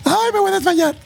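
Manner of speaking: very high hammed-up swooning wail; mock fainting mom. Son mocking his fainting mom, very high wail. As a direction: fake a dramatic faint as mom. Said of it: "Catch me".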